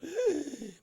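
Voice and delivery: sharp, high frightened intake of breath; startled gasp. Retold or imitated. Roommate seeing him spring up at dawn, frightened gasp. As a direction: gasp like you've seen something eerie.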